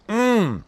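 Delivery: loud rising mm of delight; rapturous yum; high pleased mm. Real, not acted. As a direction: moan with pleasure at the taste. Tasting the food.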